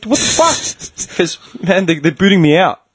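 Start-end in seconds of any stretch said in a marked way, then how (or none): 0.1-1.2 high, slow breathless disbelief; whispered what the fuck; stunned whisper. Retold or imitated. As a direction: gasp it out in shock. Seeing injured mate wheel himself.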